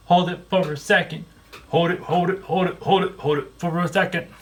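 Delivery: high sing-song repeats; sing-song coaxing. Real, not acted. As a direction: coax it in a lilting chant.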